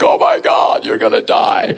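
hammed-up panic, half-shouted; a mock shout of alarm; mock alarmed cry. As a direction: play the relatives sure he will die of hunger. Family alarmed at his fast.

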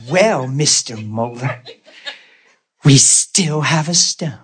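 slow cartoon-villain gloating; sinister gloating nurse. Nurse announcing the stone remains, mock-sinister gloat. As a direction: savor the bad news, slowly. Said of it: "she was gloating in it".

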